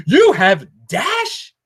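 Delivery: very slow, drawn-out You have Dash?; slow mock disbelief at Dash. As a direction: stretch it out like a stunned viewer. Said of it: "people are like".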